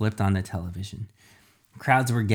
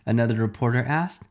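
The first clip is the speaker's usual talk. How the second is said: ordinary delivery, nothing marked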